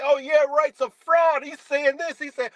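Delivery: high, mocking whine of a doubter; mocking critic. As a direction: sneer the critics' words back at them. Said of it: "they wanna come over here and say".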